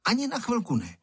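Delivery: high-pitched, emphatic no; firm refusal. A narrator's reading. Boy guarding the kite refuses his friend, firmly. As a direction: refuse outright, voice jumping up.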